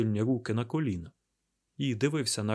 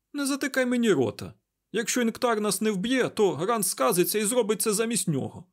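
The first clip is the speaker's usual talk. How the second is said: high, loud, heated protest; agitated retort; 11 semitones higher, 5 dB louder than usual. A narrator's reading. Mat refuses to be silenced by Perrin, agitated. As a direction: fire back in high-pitched indignation.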